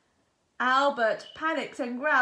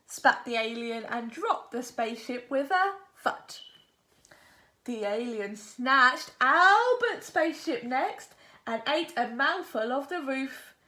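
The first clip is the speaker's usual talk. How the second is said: usual speaking voice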